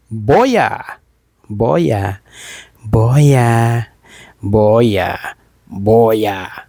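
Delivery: repeated hyped shout of the name; brand call. Acted. Hyping the new mic by calling its brand name.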